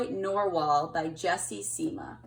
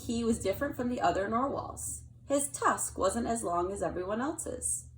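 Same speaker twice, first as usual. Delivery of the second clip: put-on gentle storytelling read to kids; picture book reading. A narrator's reading. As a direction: read it like to a child at bedtime.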